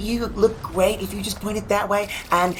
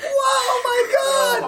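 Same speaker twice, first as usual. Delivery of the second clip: much higher, shrieking delight; delighted squeal. Real, not acted. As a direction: squeal over something cute. Squealing at the tiny robots.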